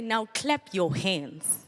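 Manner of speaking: slow, drawn out instruction; slow command. Shown as a demonstration. Speaker demonstrating the command to students, slow. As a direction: give it slowly. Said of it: "And then I'm gonna say".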